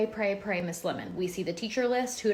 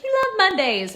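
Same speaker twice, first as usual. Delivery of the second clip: mom's teasing line, much higher and louder; imitating mom; 13 semitones higher, 9 dB louder than usual. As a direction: squeal it like mom pointing it out. Mom voiced in retold story.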